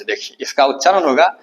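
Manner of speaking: ordinary delivery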